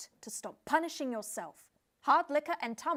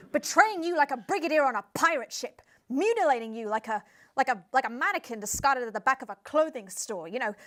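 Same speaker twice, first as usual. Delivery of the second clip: loud, impassioned tirade; hammed-up dramatic harangue; overblown rant; 2 semitones higher, 8 dB louder than usual. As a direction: pile on the metaphors at full volume. Guest's mounting rant of overblown metaphors.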